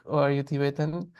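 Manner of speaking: ordinary delivery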